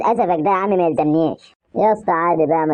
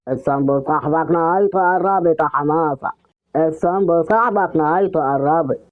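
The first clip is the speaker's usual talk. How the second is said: eerie mystery voice. Acted. Possessed friend repeating a doom warning to scare Sambo, eerie put-on voice. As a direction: chant the warning like a ghost. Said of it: "ايه الصوت ده ومين اللي بيقول كده؟".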